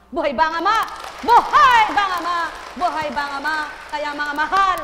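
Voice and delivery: booming preacher's call; loud preaching call. Shown as a demonstration. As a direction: call it out to the congregation. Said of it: "Kahit ganito ang boses mo".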